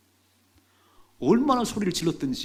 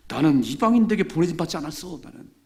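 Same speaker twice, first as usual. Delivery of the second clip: stern refusal; 3 semitones higher, 7 dB louder than usual. Acted. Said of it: "정색을 하시네요".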